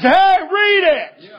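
high, drawn-out holler; preacher's scream. Retold or imitated. Preacher defending how he told the boy to read, high drawn-out scream. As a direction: scream the command, stretched out slowly. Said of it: "I can scream at you".

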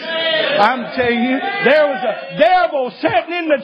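high, drawn-out pulpit shout; full-force preaching. Real, not acted. Preacher testifying to his congregation, rising full-force holler.

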